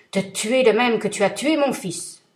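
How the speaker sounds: thundering vengeful genie; terrible genie voice. Acted. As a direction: roar it down at him like a giant. Genie speaks in story.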